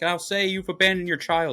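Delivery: high-pitched imitation of a badgering preacher; mock preacher's demand. Preacher makes Daniel confess about his child, high mock demand. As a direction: drive the order up high, hammed-up.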